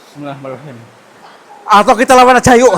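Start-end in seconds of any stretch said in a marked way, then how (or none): 1.7-2.7 loud, fired-up call to fight back; rally the team loudly; bold shout. Real, not acted. Proposing to fight the spirit.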